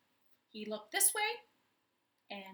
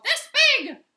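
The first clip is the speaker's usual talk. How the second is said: much higher and louder cry; cried out; 19 dB louder than usual. A narrator's reading. Parrot cries out how big Big Bro is. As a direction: shout it with wings spread. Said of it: "he cried".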